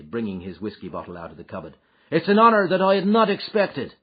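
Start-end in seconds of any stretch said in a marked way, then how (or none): 2.1-3.9 hearty welcome, higher and louder. Acted. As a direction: greet the boss with big hearty cheer. McMurdo flatters the boss.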